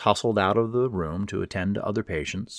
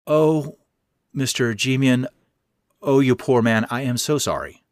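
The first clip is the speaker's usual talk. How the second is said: gushing sympathy; 5 semitones higher, 6 dB louder than usual. Acted.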